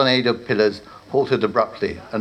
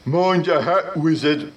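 higher, offhand troll voice; casual troll; 7 semitones higher than usual. Acted. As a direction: tease like a lazy troll guard. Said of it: "the troll remarked casually".

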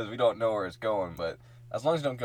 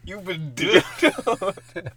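announcer voice; 6 semitones higher, 8 dB louder, much slower than usual. Pretend. Repeats catchphrase, slower.

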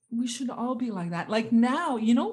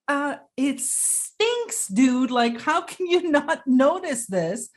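disgusted complaint; 5 semitones higher, 4 dB louder, slower than usual. Retold or imitated. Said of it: "And I'm like".

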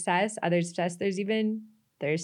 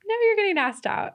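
high-pitched mock voice; 13 semitones higher, 4 dB louder than usual. Acted quote, high pitch jump.

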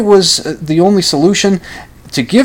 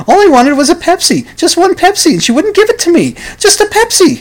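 building whine; 10 semitones higher than usual. Acted. Frustrated son pleading for one Pepsi, rising to a high whine. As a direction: pitch climbing higher on each Pepsi.

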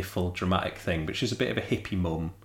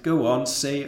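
higher, lightly acted heckle; mildly raised jeering call; crowd's cry; 6 semitones higher, 3 dB louder than usual. A narrator's reading. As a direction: goad her as one of the crowd. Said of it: "they cried".

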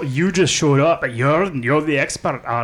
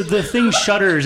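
ordinary talk

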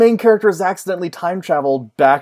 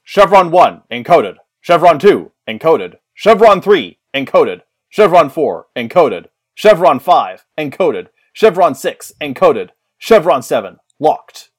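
louder, announcer-style chevron countdown; Stargate dialing sequence; 7 dB louder than usual. Pretend. Host reading the podcast letters as a Stargate dialing sequence, loud and formal. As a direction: call each chevron like gate control. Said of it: "they're pronounced".

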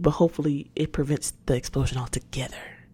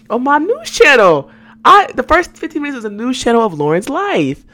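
playful; 8 semitones higher, 9 dB louder than usual. Real, not acted. Joking news channel.

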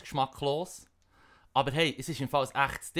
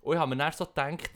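usual speaking voice

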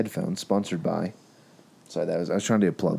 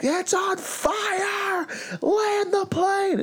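panicked scream; much slower than usual. Retold or imitated. Woman across the aisle shrieking at the crew in panic. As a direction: screech it like a terrified passenger. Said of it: "She's like".

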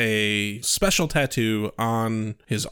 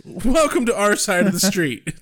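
higher, amused welcome; laughing welcome; 6 semitones higher than usual. Real, not acted. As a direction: welcome them through a laugh.

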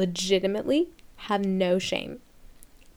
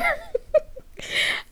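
high breathless laugh. Real, not acted. Laughing at fuckboy line.

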